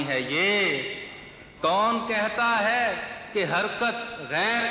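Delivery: measured mushaira declamation, words drawn out; lilting Urdu verse delivery; poetry recitation. Acted. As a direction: recite the couplet to a listening crowd.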